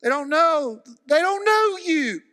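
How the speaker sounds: high, loud, slow lament; grieving shout from the pulpit; anguished cry. Real, not acted. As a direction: cry it out over the lost. Climactic cry over the people.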